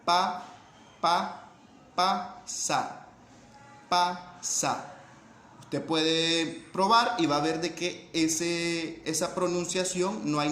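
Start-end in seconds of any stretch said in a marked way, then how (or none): 0.1-4.9 pa clipped with a puff of air, then sa; teacher sounding out pa, sa; syllable demo pa sa. Shown as a demonstration. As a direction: spell out pa and sa syllable by syllable. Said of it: "Pronunciémosla".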